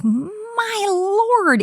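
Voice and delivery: high, stunned gasp of "My Lord"; shocked. Retold or imitated. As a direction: react like the price just floored you. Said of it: "I was like".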